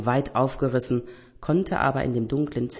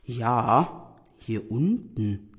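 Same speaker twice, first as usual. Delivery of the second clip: zaghaft; 3 semitones lower, much slower than usual. Acted. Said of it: "ebenfalls zaghafte Stimme".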